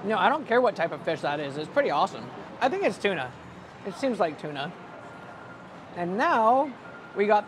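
chewing while talking; talking through food; mouth full. Real, not acted. Seen 1: Bites the roll at -1.0, then talks with his mouth likely still full.

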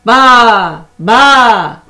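loud, high bleating baa; sheep bleat. Acted. The sheep calls to the spider, loud bleating. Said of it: "bleated the sheep".

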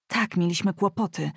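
plain reading voice

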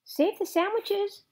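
high, loud yell of alarm. A narrator's reading. Gloria Dump yells to save the party food, loud. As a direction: shout it over the storm. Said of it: "Gloria Dump yelled".

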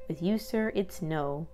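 ordinary delivery, nothing marked

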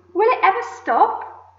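high, loud, drawn-out shout. A narrator's reading. Aunt amazed at the swelling peach, shouting.

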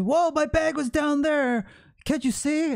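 high-pitched indignant retort, mimicked; retold scolding like an angry little child; imitated angry yelling. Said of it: "stormed off like a angry little child".

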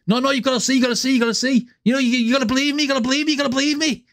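mock Scouser swearing he's telling the truth; Scouse impression. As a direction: plead in a Liverpool accent, over and over. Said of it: "all you'll get off them is".

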